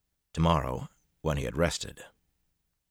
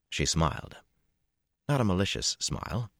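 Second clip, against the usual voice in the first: ordinary delivery, nothing marked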